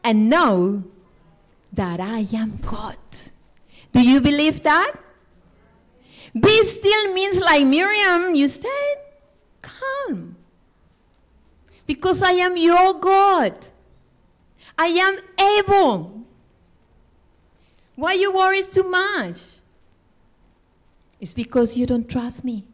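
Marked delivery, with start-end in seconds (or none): 0.0-3.0 loud, slow, solemn Be still; scripture reading. Acted. Scripture quoted with weight. 3.9-19.4 loud, high voice of God to Miriam; God comforting Miriam, preached loudly; preaching. Acted. Preaching at full force.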